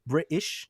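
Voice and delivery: "British" with the T dropped to a glottal catch. Shown as a demonstration. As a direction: say "British" with no T, as a question. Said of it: "say British without the T".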